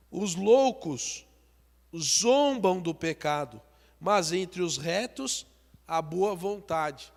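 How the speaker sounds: slow preacher's Bible reading; solemn scripture reading. Acted. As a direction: read it out to the congregation, slowly. Reads a Proverbs verse.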